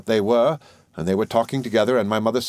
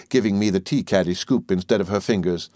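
plain reading voice

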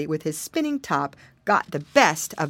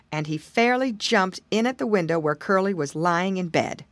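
usual speaking voice

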